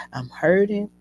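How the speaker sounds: usual speaking voice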